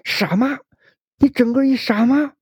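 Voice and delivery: high, loud shouted insult at his mother; child's yell. Acted. Angry son yelling at his mother, high and loud. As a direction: hurl it at her like a stab. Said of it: "孩子吼着说".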